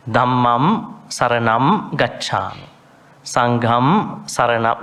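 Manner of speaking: slow "Dhammam Saranam" and "Sangham Saranam", each closing on a held m; intoned refuge line with clear m endings; M-sound endings. Shown as a demonstration. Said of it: "It ends in M".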